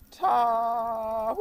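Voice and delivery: high, loud long call across the hills; a shepherd calling his sheep from afar; shepherd's call. Retold or imitated. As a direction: call the flock home. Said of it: "A shepherd's call".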